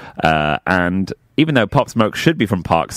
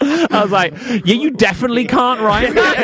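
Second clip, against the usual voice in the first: squealing with laughter. Real, not acted.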